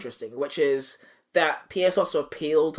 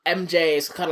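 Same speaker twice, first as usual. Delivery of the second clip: normal talking voice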